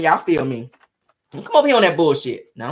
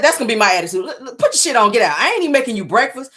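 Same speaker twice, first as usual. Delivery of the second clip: usual speaking voice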